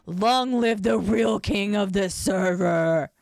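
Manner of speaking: loud, drawn-out royal proclamation; mock battle cry. Streamer play-acting a war for the server throne, mock battle cry. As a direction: proclaim it slowly and loudly, hammed-up.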